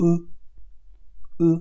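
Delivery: উ as ü, pressed from inside with the tongue; ü with inner pressure; pressed ü sound. Shown as a demonstration. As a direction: push উ out as a pressed umlaut ü. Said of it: "ভিতর থেকে একটু চাপ দিয়ে বলতে হবে".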